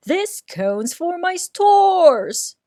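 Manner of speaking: high, loud and slow; loud slow claim. A narrator's reading. Bruce claims cone.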